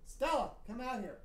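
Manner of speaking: high, soft calling voice; calling Stella over. Real, not acted. Breaks off to call Stella.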